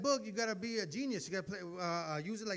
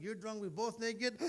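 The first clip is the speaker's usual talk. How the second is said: ordinary talk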